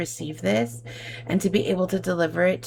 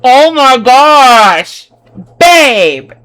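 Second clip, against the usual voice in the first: drawn-out, much louder thrilled cry; excited loud outburst; 5 semitones higher, 15 dB louder, much slower than usual. Real, not acted. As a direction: burst out in real delight, stretching oh my gosh. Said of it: "That was probably so loud".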